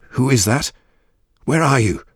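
high, loud, urgent shout. A narrator's reading. Captive judge calls to the unseen voice, shouting. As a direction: call out loudly, pitch leaping up. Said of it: "she shouted".

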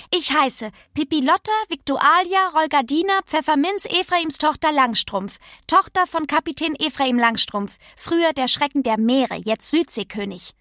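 stolze Kinderstimme, höher als die Erzählung; zähle als Pippi stolz die Namen auf; kecke Pippi. Acted. (stolze Pippi-Stimme)

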